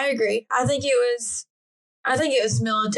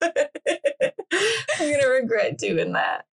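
laughing; 4 semitones higher, much slower than usual. Real, not acted. Laughing after the smoky impression.

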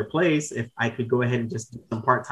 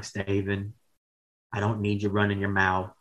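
a lightly voiced boss, lower and softer, scolding; imitating the manager's voice; 3 semitones lower, 5 dB quieter than usual. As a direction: mimic the old manager's distinct way of talking, scolding him. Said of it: "he talked like this".

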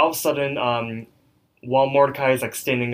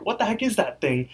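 high-pitched, lightly acted astonishment; mock disbelief; 9 semitones higher than usual. Mordecai sees the thing in Rigby's chest, high mock disbelief. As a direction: imitate a startled friend, pitched up.

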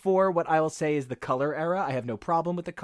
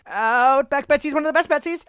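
Betsies repeated higher and louder, in a gushing squeal; fan squeal; 7 semitones higher, 6 dB louder than usual. Real, not acted. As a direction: say "Outback Betsy is one of the best Betsies" again, higher, louder and gushing. Repeats gushing, more intensely.

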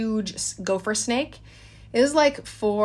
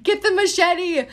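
urgent call to daughter; 9 semitones higher, 3 dB louder, slower than usual. Retold or imitated. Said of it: "I'm like".

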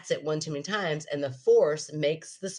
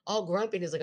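normal talking voice

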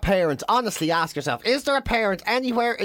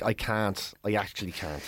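low, soft, giving-up voice; bow out quietly, as if it is too much; mock defeated; 8 semitones lower, 7 dB quieter than usual. Comic refuses to hear the joke out, low and defeated.

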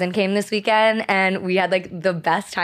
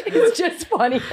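high laugh through the words; wheezing laugh; 7 semitones higher than usual. Real, not acted. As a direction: laugh while speaking, wheezing. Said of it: "You gotta stop wheezing".

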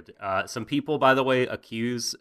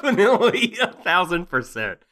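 laughing; 10 semitones higher, 9 dB louder than usual. Real, not acted. Laughing agreement with cohost.

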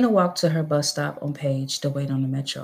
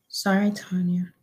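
sad apology; 3 semitones higher than usual. A narrator's reading. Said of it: "Ayanna said sadly".

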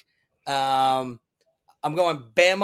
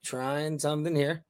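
muffled, faint line; broken-up call audio; bad connection; 7 dB quieter, slower than usual. Real, not acted. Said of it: "Your connection is terrible".

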